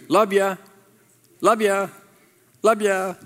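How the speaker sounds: tossed-off breezy love ya; casual love ya. Shown as a demonstration. Pastor mocking a casual love ya to the church, breezy. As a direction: say it like a quick throwaway goodbye. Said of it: "as opposed to".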